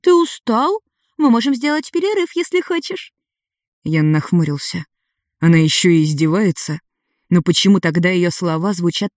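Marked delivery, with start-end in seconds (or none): 0.0-3.1 high, sweetly mocking young girl; teasing girl. A narrator's reading. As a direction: play the cheeky fire girl, sing-song. Voices girl Ada.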